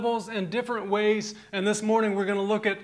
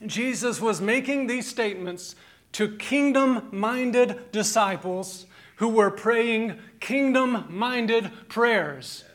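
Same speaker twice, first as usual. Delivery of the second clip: ordinary talk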